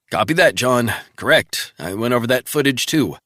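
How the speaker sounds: crisp agent reporting in; colleague on the phone. Acted. Travell briskly reporting in to Resnick by phone.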